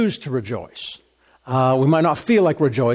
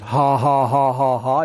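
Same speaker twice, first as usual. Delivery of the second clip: even, spoken ha-ha-ha; put-on laugh with no mirth; deliberate laugh; 3 semitones lower than usual. Shown as a demonstration. Preacher telling listeners you can just laugh, deliberate fake laugh. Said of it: "you can just laugh".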